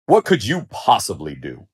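scornful, condescending question; dismissive lion. A narrator's reading. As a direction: brush the mouse off with contempt. Lion mocks the mouse's offer.

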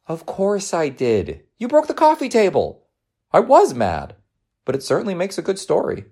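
high, animated mom voice; motherly exasperation with humour; mock-stern mother. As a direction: scold playfully while encouraging.

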